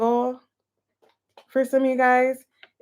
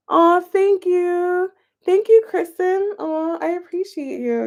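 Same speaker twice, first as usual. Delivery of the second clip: cutesy thanks; 7 semitones higher than usual. Real, not acted. Thanking viewers for live gifts.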